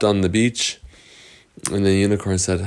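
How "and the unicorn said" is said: usual speaking voice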